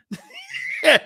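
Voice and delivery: high, loud burst of laughter; loud cackle. Real, not acted. Host laughing at his celebrity jab, loud high cackle. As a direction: cackle at his own put-down.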